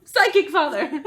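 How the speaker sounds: high, loud, slow dramatic trainer call; hammed-up Pokemon trainer shout. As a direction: summon a Pokemon in battle. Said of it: "like he's like a Pokemon".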